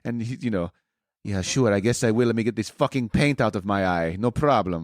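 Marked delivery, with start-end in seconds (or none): 1.2-4.8 low mock Italian accent; lower, grumbling Italian accent. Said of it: "that was meant to be my best Italian, accent".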